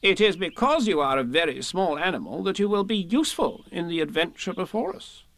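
businesslike Rabbit. A narrator's reading. Rabbit recruiting small Piglet, self-assured. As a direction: persuade with brisk authority.

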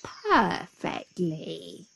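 very slow, drawn-out satisfaction; sly grin. A narrator's reading. Jackal having trapped the tiger, slow and smug. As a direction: savour the word through a grin. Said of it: "grinned the Jackal".

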